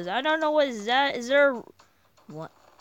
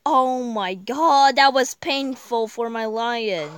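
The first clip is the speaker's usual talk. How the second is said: pained exclamation; 8 dB louder, slower than usual. Real, not acted. Reacting to buzzsaw hit.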